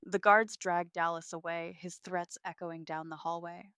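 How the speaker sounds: usual speaking voice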